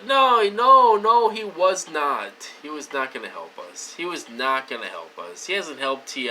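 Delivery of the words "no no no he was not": loud, high, drawn-out no's; heated, louder refusal dropping toward the end; getting loud. Real, not acted. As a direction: burst out in emphatic denial, voice rising sharply. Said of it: "I'm sorry for getting loud".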